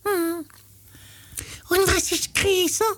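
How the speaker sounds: very high falsetto monkey voice; high hum, then a falsetto quiz question; Äffle cartoon monkey. Äffle asking Pferdle what is bigger, high monkey voice. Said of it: "das Äffle so".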